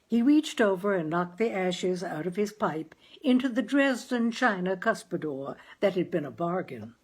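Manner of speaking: plain reading voice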